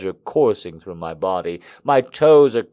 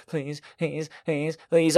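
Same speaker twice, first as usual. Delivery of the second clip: desperate begging; 4 semitones higher, 7 dB quieter than usual. Acted. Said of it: "I cry out".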